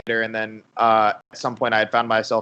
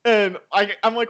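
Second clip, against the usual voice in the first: ordinary talk, nothing marked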